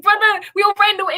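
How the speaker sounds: a playful put-on Wakanda accent, said to a friend; imitating Wakanda accent. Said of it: "talking like people do in Wakanda".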